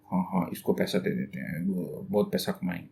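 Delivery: low, quiet mutter under the breath; muttering to himself. Shown as a demonstration. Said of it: "ऐसे मन ही मन बड़बड़ाते हैं ना". (मन ही मन धीमे से बड़बड़ाना)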